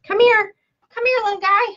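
high, loud mock coaxing; playful high-pitched beckoning. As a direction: call it over like a little pet. Calling to a dropped raffle slip.

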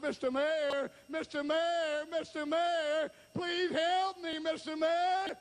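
high, drawn-out beggar's call; insistent high cry for help; hollering. Acted. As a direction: holler after him, climbing as you plead. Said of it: "and he hollered".

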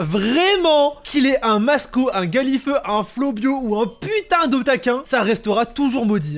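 excited rant. Real, not acted. Ranting about cursed spot.

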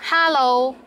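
Cantonese accent. Shown as a demonstration. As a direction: demonstrate a Cantonese-accented hello. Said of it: "but with a Cantonese accent".

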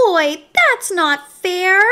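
high, drawn-out whine; little creature's slow, high complaint; whining pigtail. A narrator's reading. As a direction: whine about unfair treatment. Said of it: "whined the pigtail".

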